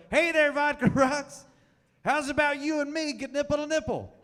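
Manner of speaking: hammed-up high yell across the street; yelled pickup line. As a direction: bellow the cheesy line at your wife from the car. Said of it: "and I yell out the window".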